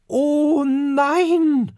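high, loud shocked outcry; dismayed cry. A narrator's reading. Duftikus discovers the red stone is gone, high and loud. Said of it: "ruft Duftikus Dickbauch".

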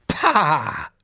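loud, dismissive scoff; young dragon scoffing at a boast; scornful pah. Acted. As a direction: scoff it away.

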